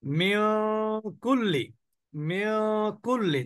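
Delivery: মিন held in a nasal hum before a falling কুল্লি; ghunnah demo. Shown as a demonstration. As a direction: linger in the nose on মিন, then say কুল্লি. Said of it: "আমরা এখন practice করলাম".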